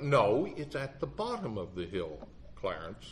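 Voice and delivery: understated, puzzled correction trailing down; confused witness. Retold or imitated. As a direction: quietly set the lawyer straight. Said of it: "The witness looked really confused".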